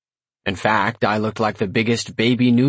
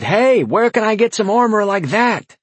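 shout; 11 semitones higher, 4 dB louder than usual. A narrator's reading. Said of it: "A couple even shouted".

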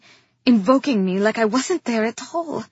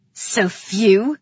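louder, sharp demand; 6 dB louder than usual. A narrator's reading. King interrupting his brother's report, demanding. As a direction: cut in demanding an answer. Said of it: "Calyx demanded".

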